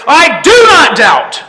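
high, slow, full-voiced shout; triumphant cry. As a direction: cry it out as a drawn-out declaration of faith. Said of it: "Oh, may I cry".

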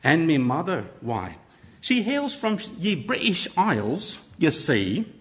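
a light mixed Mexican and British accent. Shown as a demonstration. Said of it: "Use different voices, subtle accents".

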